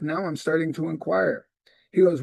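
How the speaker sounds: normal talking voice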